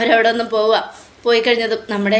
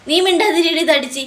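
high-pitched acted snub; cross silencing; 7 semitones higher than usual. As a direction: shut them up crossly. Acted quarrel, silencing with an insult. (ദേഷ്യം അഭിനയിച്ച് ഉയർന്ന സ്വരത്തിൽ വായടപ്പിക്കൽ)

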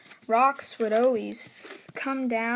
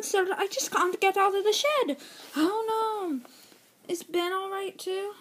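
higher character voice; lightly voiced small engine; 7 semitones higher than usual. Lightly voiced worried engines answering Sir Topham Hatt.

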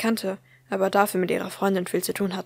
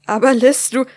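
startled plea; 4 semitones higher, 11 dB louder than usual. Acted. Friend pleads as she hangs up.

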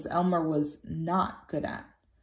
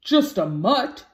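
slow, astonished fatherly voice; father dog amazed by the insult; indignant dad; 4 semitones higher, 4 dB louder, slower than usual. A narrator's reading. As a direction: scoff at the very idea. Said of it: "said Axel".

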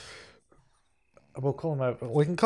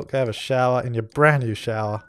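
ordinary delivery

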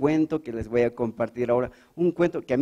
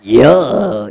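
ugly dubbed hero voice, louder; Chapulín Colorado; 11 dB louder than usual. Acted. Hero answers the call in an ugly Spain-dubbed voice. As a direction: bark it like a bad Spain dub. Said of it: "fea la voz del Chapulín".

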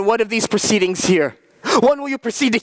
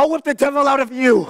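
higher, forceful threat; angry threat; 4 semitones higher than usual. Acted. Proctor threatens Mary with the whip, angry. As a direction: bear down on her with fury.

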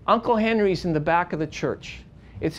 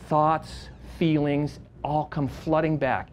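ordinary delivery

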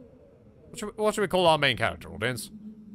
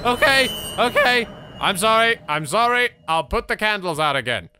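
loud, high, hurried apology; panicked apology; 6 semitones higher, 6 dB louder than usual. Real, not acted. Apologizing to the angry box, loud and panicked. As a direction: frantically placate something that frightens you.

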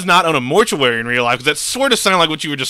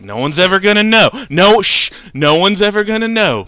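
frantic reassurance; 6 semitones higher, 5 dB louder than usual. Retold or imitated. Reassuring stepdad after burying kid.

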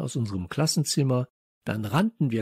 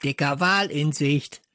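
croaky lookout parrot; parrot squawk; 3 semitones higher than usual. Acted. Parrot announcing the teacher's arrival, croaking squawk. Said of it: "krächzte Störtebeker".

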